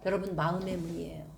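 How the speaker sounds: ordinary delivery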